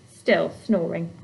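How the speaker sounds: plain reading voice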